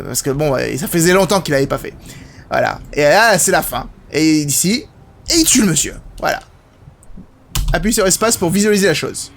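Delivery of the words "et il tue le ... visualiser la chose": usual speaking voice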